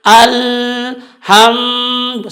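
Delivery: slow drawn alhamdu; wrong alhamdu. Shown as a demonstration. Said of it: "salah juga". (alhamdu salah)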